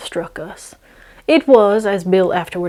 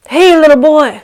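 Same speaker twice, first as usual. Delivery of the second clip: put-on loud, higher call to a boy; 8 semitones higher, 8 dB louder than usual. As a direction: call out sweetly to the kid. Bill calling to the kid.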